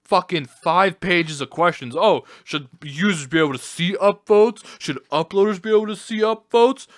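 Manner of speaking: sarcastic high imitation of the site staff; mocking the mods. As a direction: parrot their poll questions with a sneer. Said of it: "So, like".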